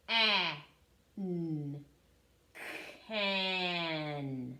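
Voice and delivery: K-A-N said sound by sound, then blended into Can; teacher blending sounds into a word. Shown as a demonstration. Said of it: "let's sound out this word".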